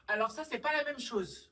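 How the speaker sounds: high, loud protest; indignant defence. Acted. Sister defends herself against brother's accusation, indignant.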